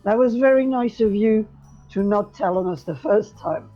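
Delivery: ordinary talk, nothing marked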